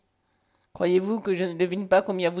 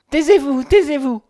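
loud, high, urgent cry; overwhelmed, crying out to silence him; distraught protest; 6 semitones higher, 8 dB louder than usual. A narrator's reading. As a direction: cut him off in agitation, voice raised. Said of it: "balbutia Nathalie".

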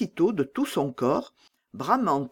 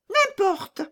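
put-on bold robber girl. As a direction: toss it off like a fearless bandit girl. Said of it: "dit-elle".

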